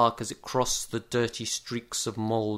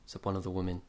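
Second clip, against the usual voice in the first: plain reading voice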